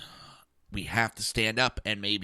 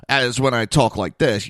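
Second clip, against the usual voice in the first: louder, hard-edged delivery; hard harsh voice; 7 dB louder than usual. Shown as a demonstration. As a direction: sound abrasive and untrustworthy. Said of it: "Holy shit, he's an asshole".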